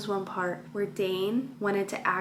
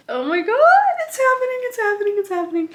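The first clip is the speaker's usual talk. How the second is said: much higher, much louder squeal of delight; excited squeal; 11 semitones higher, 7 dB louder, faster than usual. Real, not acted. Reader to her viewers as the couple finally kiss, squealing. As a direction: burst out like the moment finally came.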